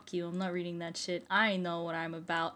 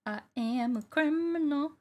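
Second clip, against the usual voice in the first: high mock confession; 5 semitones higher than usual. Player admitting to being a criminal, high mock confession. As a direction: lift it high and stretch it.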